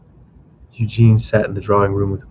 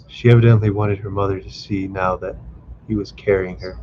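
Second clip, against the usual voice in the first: ordinary delivery, nothing marked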